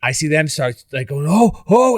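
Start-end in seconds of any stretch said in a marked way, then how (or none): 1.3-2.0 high, loud rising-panic "oh"; mimicked alarmed cries. Retelling the announcers as the runner breaks free, alarmed. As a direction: act out a crowd seeing it break loose.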